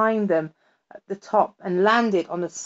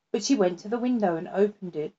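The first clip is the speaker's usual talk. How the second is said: ordinary delivery, nothing marked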